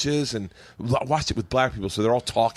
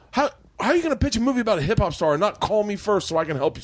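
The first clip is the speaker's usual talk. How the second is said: fast pitch; 9 semitones higher, faster than usual. Retold or imitated. Will's rapid call retold.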